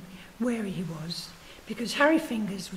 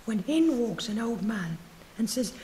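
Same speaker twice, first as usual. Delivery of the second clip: ordinary delivery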